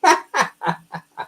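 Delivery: high laugh at own punchline. Real, not acted. Joke teller after the farmer's punchline, high laugh. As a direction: crack up at your own joke.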